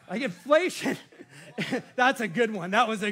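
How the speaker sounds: chuckling through the word; laughing at own joke. Real, not acted. Preacher cracking himself up at his own pun, laughing. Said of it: "I crack myself up sometimes".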